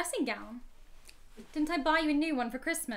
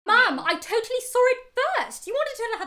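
loud, high-pitched squabbling; heated bickering, voice raised; indignant protest; 9 semitones higher, 9 dB louder than usual. Acted. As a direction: protest hotly to your mother. Daughter arguing back in quarrel.

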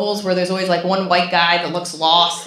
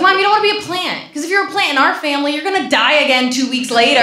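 exasperated plea; 6 semitones higher, 4 dB louder, faster than usual. Retold or imitated. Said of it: "I was like".

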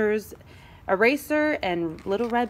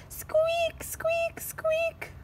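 tiny very high squeaks; highlighter squeak; 6 dB quieter, much slower than usual. Acted. Highlighter marks the paper, squeaking. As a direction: squeal like a marker tip.

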